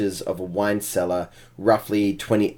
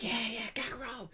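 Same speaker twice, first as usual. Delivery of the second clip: small, high, hushed cheer; kobold followers' cheer; 13 semitones higher, 17 dB quieter than usual. Acted. Kobold minions hailing their leader, high and soft. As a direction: imitate a distant little crowd hailing its leader, softly. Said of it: "all the other kobolds go like".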